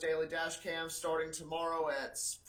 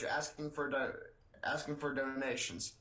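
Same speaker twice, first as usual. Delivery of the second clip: ordinary delivery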